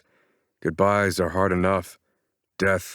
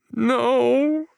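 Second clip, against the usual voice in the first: high, broken cry of grief; anguished; 3 dB louder than usual. A narrator's reading. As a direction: let it break as she crumbles. Mother flatlines.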